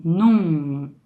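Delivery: "num" with the last sound held; final sound demo. Shown as a demonstration. Teacher contrasting M and N, "num" ending held. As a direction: hold the nasal ending of "num". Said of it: "por exemplo, eu falo assim".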